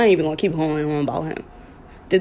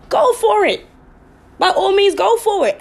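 emphatic; 11 semitones higher, 4 dB louder than usual. Real, not acted. Emphatic encouragement.

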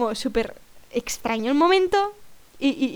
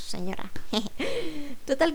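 ordinary talk

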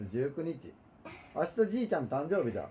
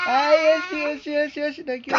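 mock soothing; 10 semitones higher, 8 dB louder than usual. Soothing the crying child.

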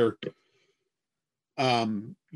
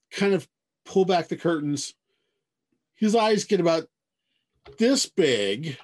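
ordinary talk, nothing marked

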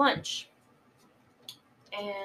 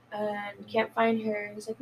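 normal talking voice

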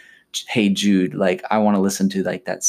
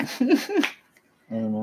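drawn-out mock emo whine; 4 dB quieter, much slower than usual. Mocking emo song, drawn out.

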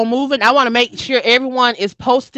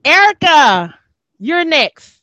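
calling a name out loud, higher; 5 semitones higher, 5 dB louder, slower than usual. Real, not acted. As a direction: call the next guest up loudly. Host calling next guest.

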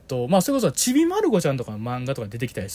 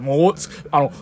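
ordinary talk

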